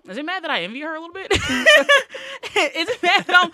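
high, loud laughing question. Real, not acted. Laughing while asking her friends about her envy.

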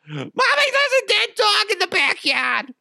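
very high mock kid wail; horrified child. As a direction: cry out to Mommy like a horrified little kid. Imagined child finds dead dog.

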